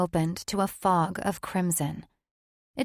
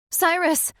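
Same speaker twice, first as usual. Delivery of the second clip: high, loud anxious call; 11 semitones higher, 5 dB louder than usual. Acted. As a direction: call out for him in alarm. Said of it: "she called".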